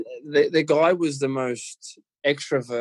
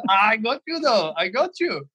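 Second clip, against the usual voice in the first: put-on higher, playful Scouse German accent; teasing in Gravesen's Scouse German accent; 10 semitones higher than usual. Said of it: "he's got this weird Scouse German accent going on".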